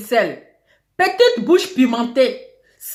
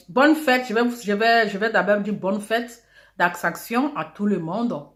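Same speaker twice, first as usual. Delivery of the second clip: usual speaking voice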